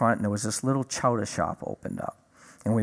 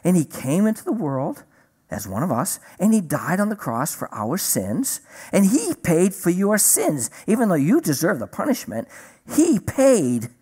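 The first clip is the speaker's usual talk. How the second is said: simple, higher-pitched, sing-song teaching with big pauses; explaining to a four-year-old; 6 semitones higher than usual. Retold or imitated. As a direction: spell it out as if to a small child. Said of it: "that's how I'm explaining it to him, like a four-year-old".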